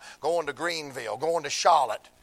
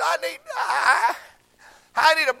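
imitating sobbing boy; 13 semitones higher, 7 dB louder than usual. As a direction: choke the words out like a crying teenager. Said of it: "he got to crying".

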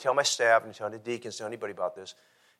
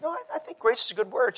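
a pitched-up imitation of the lady; lady's voice; 13 semitones higher than usual. Pastor retelling the old lady's answer in a high voice. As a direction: lift the voice high to play her.

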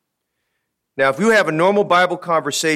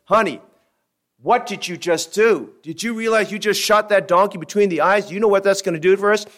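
higher, raised voice of a startled husband; a shocked husband pleading with his wife; lightly voiced panicky husband; alarmed husband; 7 semitones higher, 3 dB louder than usual. Husband alarmed at wife shooting donkey.

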